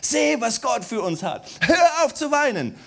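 high, loud, scolding prophet voice; loud, high and stern, cutting off the crying; harsh rebuke. Retold or imitated. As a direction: Nehemiah shouting at the weeping people to stop. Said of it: "Sie weinten und Nehemia kommt".

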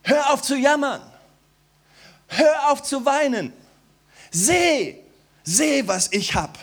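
high, loud, scolding prophet voice; loud, high and stern, cutting off the crying; harsh rebuke. Retold or imitated. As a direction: Nehemiah shouting at the weeping people to stop. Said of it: "Sie weinten und Nehemia kommt".

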